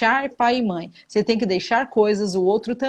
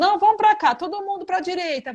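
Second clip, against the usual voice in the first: high-pitched mock order-giving; raised, urging quoted voice; wavering husband; 9 semitones higher than usual. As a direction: imitate an indecisive leader rallying everyone. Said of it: "se uma hora ele tá falando".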